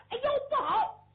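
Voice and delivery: high, loud yelp of alarm; alarmed cry. Acted. Warrior finds his hand on fire, alarmed yelp. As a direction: cry out as the fire catches you. Burning man cries out in alarm.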